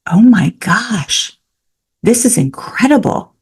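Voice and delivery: high, slow, amazed reaction; awestruck. Retold or imitated. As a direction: marvel at it, drawn out and high. Recalls first watching the movie.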